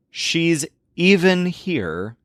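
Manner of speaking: "even" stretched out very slowly with a rise on it; stress on even. Shown as a demonstration. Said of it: "the emphasis is no longer on the subject".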